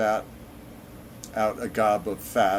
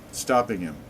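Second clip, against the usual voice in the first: plain reading voice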